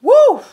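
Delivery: high, loud joyful woo; excited whoop. Real, not acted. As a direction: whoop it out for joy. Excited outburst mid-reading.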